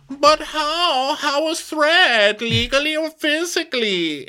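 very high put-on voice, how is threat slow; baffled, high and drawn out; mock Diana. Podcaster mocking Diana's question, very high. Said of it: "And Diana goes".

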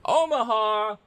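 barking a play at the line; quarterback audible call. Pretend. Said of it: "I'll call an audible here".

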